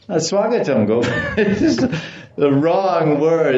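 high, amused voice breaking into laughter; laughing. Real, not acted. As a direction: laugh through the words. Laughs at the absurd greeting.

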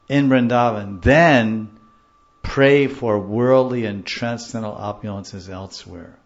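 loud vow, easing off at the end; emphatic declaration. Retold or imitated. Teacher translating the author's vow, loud and emphatic. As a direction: hit the opening words hard, then settle.

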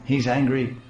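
usual speaking voice

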